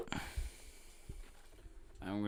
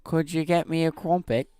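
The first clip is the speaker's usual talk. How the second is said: put-on British accent; 8 semitones higher than usual. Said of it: "all nuns are British".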